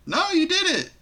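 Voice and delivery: high-pitched, squeaky blame throw-back; swooping high mock denial; mock accusation. Mimicking the absurd blame-shifting.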